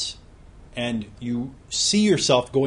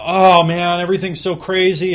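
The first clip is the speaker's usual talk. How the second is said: louder and higher, hammed-up overwhelmed sigh of a busy friend; mock frazzled exclaim; 6 semitones higher, 9 dB louder than usual. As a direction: play the friend who is always swamped, exaggerated.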